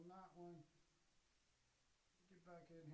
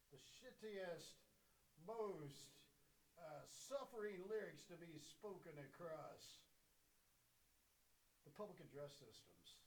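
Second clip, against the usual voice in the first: mock indignant rant; 4 semitones higher, 6 dB louder, slower than usual.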